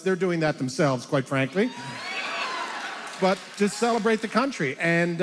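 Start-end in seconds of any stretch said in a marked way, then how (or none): 1.7-3.1 laugh. Real, not acted. Laughs at own joke.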